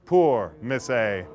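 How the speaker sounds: milk the pity, slowly; mock pity. A narrator's reading. Narrator pities rejected heroine.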